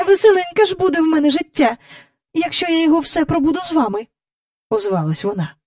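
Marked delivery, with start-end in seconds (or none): 0.1-4.1 high, fast, huffy reply; tart retort. A narrator's reading. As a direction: rattle it off like an exasperated governess. Governess retorts tartly to children.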